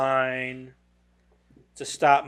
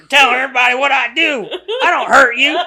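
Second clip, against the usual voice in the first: put-on Southern accent; 9 semitones higher, 9 dB louder, faster than usual. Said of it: "Your secret Southern accent comes out".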